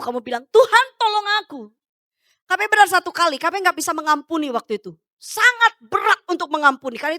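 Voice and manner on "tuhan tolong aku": higher-pitched plea to God; crying out. Pretend. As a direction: call out for God's help. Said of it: "kamu berseru". (berseru minta tolong)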